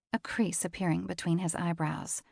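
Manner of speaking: usual speaking voice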